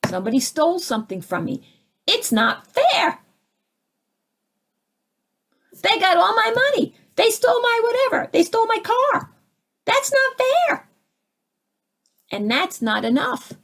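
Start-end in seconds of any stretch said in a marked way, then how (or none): 2.1-10.9 much higher, mock whining complaint; whiny victim. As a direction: whine it like a wronged child. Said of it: "comes up".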